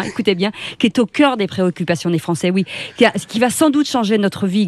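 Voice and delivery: playfully overacted excitement; hammed-up enthusiastic intro. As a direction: sell the topic with mock amazement. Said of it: "[name], lancez-moi".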